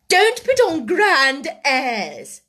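loud, slow, high scolding; severe rebuke. A narrator's reading. Headmistress rebukes the proud orphan, severely. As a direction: stretch the words with scorn.

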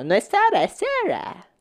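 "Sarah" jumps very high as sera, in a mocking put-on voice; snooty correction. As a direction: squeak "es Sarah" up high like a smug know-it-all. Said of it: "con ese tono de voz". (corrección pedante y burlona: "Sarah" muy aguda, como sera)

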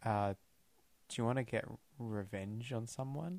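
hushed, secretive recruiter voice; stage whisper. Acted. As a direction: lean in and whisper the offer. Recruiting Anthony into Spectre.

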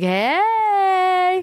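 high drawn-out hey; a hungover greeting; groggy hey. Retold or imitated. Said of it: "And I was like".

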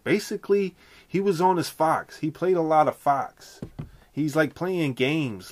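high-pitched Philly rapper impression. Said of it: "I'm working on my [name] impression".

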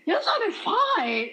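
high and giggly, half-laughing the compliment; cheeky laughing compliment to the mother; laughing tease. Retold or imitated. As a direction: tease her about her son through laughter. Teasing retelling to his mother.

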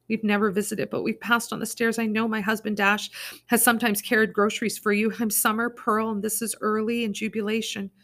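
pleading. Acted. Summer begging neighbour.

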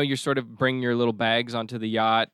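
ordinary delivery

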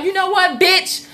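shouting down the mother on her porch; retold yelled threat at the neighbour.

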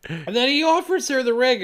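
ordinary talk

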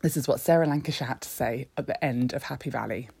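ordinary talk

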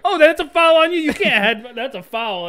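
high loud foul call; excited call. Real, not acted.

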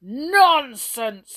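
loud, high angry dismissal; storming. A narrator's reading. King dismissing the brownies' plea, storming. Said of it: "stormed the king".

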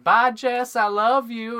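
high, loud sing-song goodbye; doting mom. Retold or imitated. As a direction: call out lovingly to her son. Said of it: "She'd be like".

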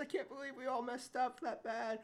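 high-pitched fake chuckling through the words; mock laughing. Retelling them laughing about the mix-up, mock laughing. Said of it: "They're all laughing about this whole thing".

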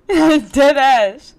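loud, high, laughing confirmation; laughing insistence. Real, not acted. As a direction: insist it is true through a laugh. Insisting, amused, after friend's disbelief.

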